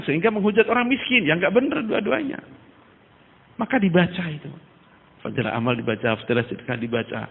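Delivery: ordinary talk, nothing marked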